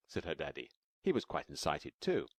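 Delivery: usual speaking voice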